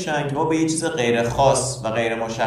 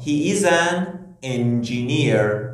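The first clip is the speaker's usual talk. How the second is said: reads the English example slowly and clearly; much slower than usual. Shown as a demonstration. Teacher reading the example slowly to students. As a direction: spell it out slowly for learners. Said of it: "به عنوان مثال".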